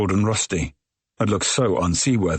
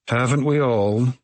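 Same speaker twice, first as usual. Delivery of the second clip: weary interruption; 5 semitones higher, slower than usual. A narrator's reading. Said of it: "she interrupts".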